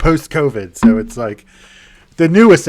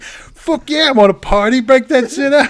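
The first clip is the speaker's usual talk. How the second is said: higher, louder gleeful yell; hammed-up eager party guy; 12 semitones higher, 4 dB louder than usual. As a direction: cheer for the coke, laughing.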